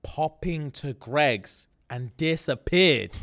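drawn-out deadpan repeat; slow deadpan. Real, not acted. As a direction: stretch each word for the joke.

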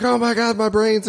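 high-pitched pretend horror; mock panic. Acting out a prank on his brothers, high mock panic. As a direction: cry out high in hammed-up alarm. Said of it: "sneeze in front of his brothers and be like".

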